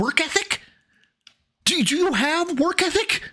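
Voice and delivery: high-pitched, incredulous pleading; exasperated. Real, not acted. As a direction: squeal it out in disbelief at your co-host. Exasperated rant at Phil.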